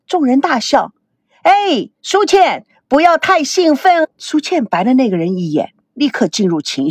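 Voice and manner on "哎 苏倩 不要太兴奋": higher, teasing caution; calling out. A narrator's reading. Trainer warns an over-excited trainee, calling out. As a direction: call across to her, pitched high and drawn out.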